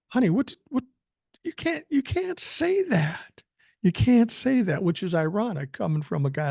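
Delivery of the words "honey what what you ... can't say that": high-pitched flustered disbelief; mock-appalled grandpa scolding; acted shock. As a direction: stammer in high, stretched-out dismay at the child. Shocked grandpa chides swearing child.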